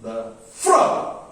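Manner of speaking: light "The", then high, punched "fro"; two-beat demo stressing "fro". Shown as a demonstration. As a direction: hit "fro" after an unstressed "The".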